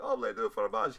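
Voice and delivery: high, loud put-on French accent; Dexter's French. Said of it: "I like that one joke".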